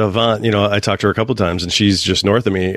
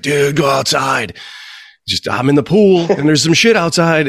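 raised pitch, drawn-out holler down the phone; urgent buddy imitation; 5 semitones higher than usual. Buddy calling at two in the morning, imitated urgent holler. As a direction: imitate a pal urgently calling you outside. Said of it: "he calls me at".

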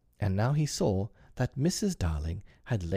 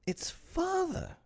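put-on slow, very high girlish cry; exclaiming; slower than usual. A narrator's reading. Wendy recognising her father, exclaiming. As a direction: draw it out in high amazement. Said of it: "exclaimed Wendy".

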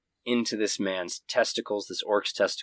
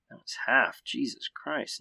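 normal talking voice

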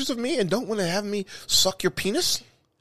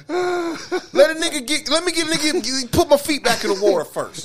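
mock crying plea; 8 semitones higher, slower than usual.

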